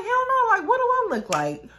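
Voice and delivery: high, loud, incredulous retort; indignant refusal. Retold or imitated. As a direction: snap back in outraged disbelief. Acted indignant refusal on phone.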